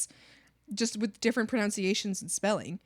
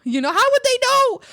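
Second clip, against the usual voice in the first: high, loud, laughing exclamation; exclaiming; 12 semitones higher, 11 dB louder than usual. Real, not acted. Defending the friend who mispronounced it, high laughing exclamation.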